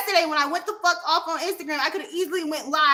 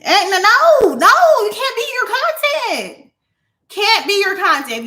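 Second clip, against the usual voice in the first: heated shout; 7 semitones higher, 9 dB louder, slower than usual. Real, not acted. Heated rant at other streamers.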